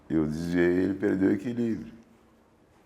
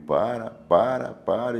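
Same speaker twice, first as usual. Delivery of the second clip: retold yell; 6 semitones higher, 3 dB louder, slower than usual. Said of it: "Gritando comigo".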